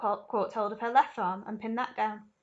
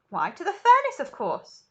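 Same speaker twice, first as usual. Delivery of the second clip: calmly; 7 semitones higher, 7 dB louder than usual. Acted. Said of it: "said Mister Wonka calmly".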